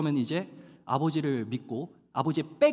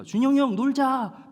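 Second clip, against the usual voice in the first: calling out; 8 semitones higher, 6 dB louder than usual. Retold or imitated. Child calling friend outside.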